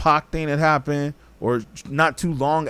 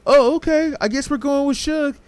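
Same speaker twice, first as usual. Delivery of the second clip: much higher, airy imitation of the girls going along; mimicking the girls' easy surrender, voice raised high; mock girly voice; 11 semitones higher than usual. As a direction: play the girls shrugging and giving in, pitched way up. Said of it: "And they were like".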